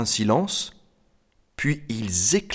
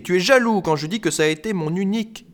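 high-pitched, offended accusation; indignant, voice pitched up; 9 semitones higher, 3 dB louder, faster than usual. Acted. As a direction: accuse in hurt outrage. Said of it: "indignée".

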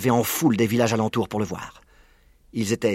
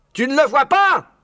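voix de Bean; 4 dB louder than usual. A narrator's reading. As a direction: question aiguë et forte. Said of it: "demanda Bean". (Bean questionne Boggies, voix haute et forte)

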